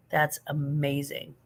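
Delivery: ordinary talk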